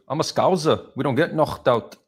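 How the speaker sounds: high-pitched Scouse impression with throaty K; Paddy Pimblett. Retelling a fighter's post-fight answer, imitated Scouse accent. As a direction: imitate a Liverpool fighter, K turned to "kh". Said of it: "And he goes".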